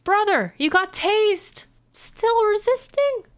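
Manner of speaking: squeaky disbelieving tease; high mocking voice. Mocking the arrested agent.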